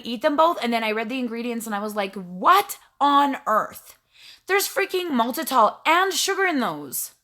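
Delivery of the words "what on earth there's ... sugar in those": stretched-out, higher-pitched outraged shock; indignant disbelief. Retold or imitated. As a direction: act out exasperated disbelief, drawing the words out. Acted shock at ingredient list.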